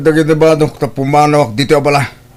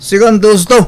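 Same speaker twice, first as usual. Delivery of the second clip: loud, higher furious yelling; angry shouting; 5 semitones higher, 5 dB louder than usual. Acted. Older brother yelling back at his sibling, furious shout. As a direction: shout him down in rage.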